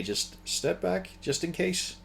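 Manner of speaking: a soft, coaxing nudge without authority; gentle. Acted. Game master voicing a trainer easing a beast back, gentle. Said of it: "to gently say".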